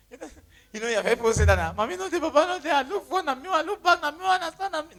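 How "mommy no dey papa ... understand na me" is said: high sing-song mock lament; hammed-up orphan's lament; mock self-pity. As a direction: whine the hard-luck story in a raised voice. Mimics a self-pitying lament.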